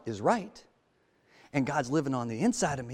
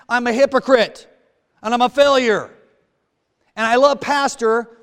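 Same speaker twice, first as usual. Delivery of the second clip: louder, higher preaching push; fired-up preaching; 9 semitones higher, 8 dB louder than usual. Real, not acted. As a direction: drive it home to the congregation. Preaching at full force.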